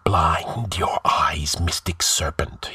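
slow chanted whisper; blood-freezing whisper. A narrator's reading. The sorcerer chants to the serpent ring, whispering. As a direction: whisper it like a chilling incantation. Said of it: "he chanted in a blood-freezing whisper".

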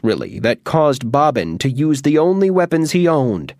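ordinary delivery

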